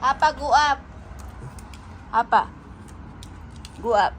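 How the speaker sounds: loud call to the pet. Real, not acted. Owner loudly calling Hapa to go up. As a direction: raise your voice to call the pet up.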